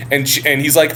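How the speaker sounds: ordinary delivery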